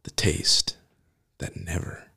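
breathy, hushed commercial whisper. Acted. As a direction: sell it in a sultry whisper.

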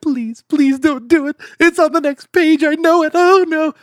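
high, hammed-up pleading; Grover. Grover near the last pages, pleading in mock dread. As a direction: beg desperately like a frightened puppet, voice way up. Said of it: "he is pleading".

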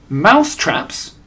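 surprised cry. A narrator's reading. Witches echoing their leader's announcement, surprised. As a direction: throw it back in astonishment. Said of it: "cried the witches".

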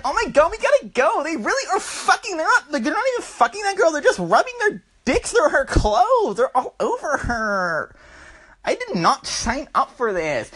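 put-on high, fast, panicked girl voice; mock-panicked high girl voice; freaked-out girlfriend. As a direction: squeal like she wants out now. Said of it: "then she's like".

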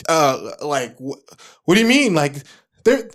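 high, loud confused panic; flustered. Retold or imitated. Overwhelmed engineer forgetting the console, high loud confused panic. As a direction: blank out and stammer in panic. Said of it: "literally frozen".

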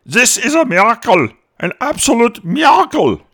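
high, loud cry of triumph; voicing Hitler, who shouts in triumph at the breakthrough; exultant shout. Hitler hailing Sedan, an elated loud shout. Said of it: "Hitler shouted".

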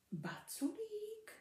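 slow, soft unvoiced wondering; puzzled whisper. Piglet wondering who the chubby one is, whispering. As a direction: breathe the word in confusion.

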